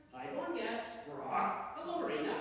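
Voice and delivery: high-pitched parrot with a squawk. Acted. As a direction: parrot back stock phrases in a high voice. Parrot mimics words back.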